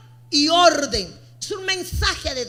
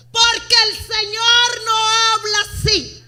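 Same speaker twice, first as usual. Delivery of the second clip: mock indignant excuse; 6 semitones higher, 5 dB louder than usual. Voicing believer's excuse.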